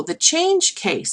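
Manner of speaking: ordinary talk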